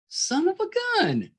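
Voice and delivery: high, slow exasperated exclamation; drawn out in mock dismay. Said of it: "I'm like".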